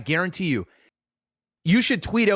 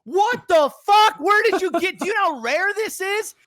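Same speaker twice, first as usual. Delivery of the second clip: entourage freaking out; 3 dB louder than usual. Retold or imitated. Entourage freaking out over item.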